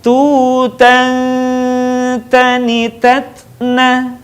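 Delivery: slow chant, long hum ending "Tutan"; held nasal. Shown as a demonstration. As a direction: hold the n of "Tutan", then continue evenly. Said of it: "tahan suaranya". ("Tutan" ditahan dengung, dilantunkan pelan)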